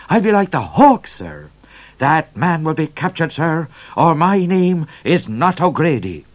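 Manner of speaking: high, emphatic oath with dropped "sir"; self-important trooper. A narrator's reading. Trooper vowing to the governor to catch Midnight, self-important.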